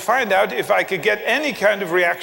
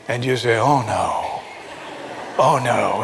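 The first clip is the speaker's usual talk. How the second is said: low, weary mock groan; mock dismay; 8 semitones lower than usual. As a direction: imitate a dreading congregation, pitched low. Mimics congregation's groan at third point.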